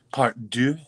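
DEUX said the French way, like "duh"; French word. Acted. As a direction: say "deux" as a playful French flourish. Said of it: "as the French say".

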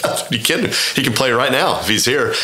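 high, eager are-you-kidding; excited disbelief. Retold or imitated. Telling the PGA caller Tiger can come anytime, high and excited. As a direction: gush like it's a dream come true.

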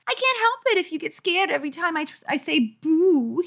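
put-on high babyish mean voice; squeaky, taunting small-boy voice; nasty little brother. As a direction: sneer like a baby being mean. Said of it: "he replied nastily".

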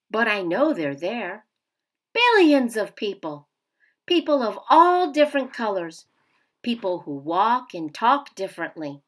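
gentle picture-book read-aloud; reading to children. A narrator's reading. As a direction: read it like story time for kids. Teacher reads picture book aloud.

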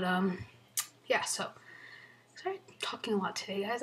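normal talking voice

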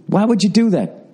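ordinary talk

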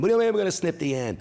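very high, loud fast panic; panicked kid. Retold or imitated. Boy shrieks in alarm at the mother's plan, fast and very high.

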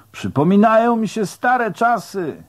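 high-pitched call over the water; shouting back. A narrator's reading. Rower explaining himself to the man on shore, shouting back. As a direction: holler the reply to shore. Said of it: "odkrzykłem temu na brzegu".

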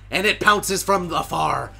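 loud, slow dramatic narrator; dramatic narration. Acted. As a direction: build the tension of the pounce.

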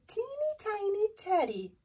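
high storybook squeak on the small words; teeny tiny voice. A narrator's reading. Narrator describes a tiny bear.

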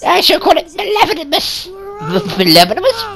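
ordinary talk

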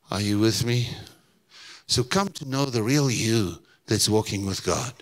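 ordinary delivery

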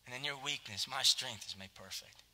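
soft, hushed voice of God; God whispering grace to Michael; gentle whisper. Retold or imitated. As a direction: breathe it like a tender whisper. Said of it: "He says".

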